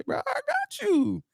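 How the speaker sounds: high, drawn-out retold reply; playful mock reassurance, way up high; eager agreement.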